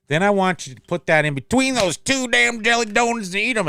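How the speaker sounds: high-pitched, worked-up Southern accent; Southern accent climbing with excitement; excited Southern accent. Real, not acted. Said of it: "I get a Southern accent for some reason".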